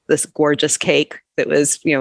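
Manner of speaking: ordinary talk, nothing marked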